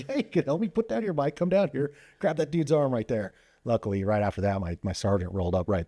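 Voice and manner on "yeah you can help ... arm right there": high, strained orders mid-struggle; urgent orders. Retold or imitated. Officer ordering the news anchor to help, high urgent orders. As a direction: bark it out while pinning him down.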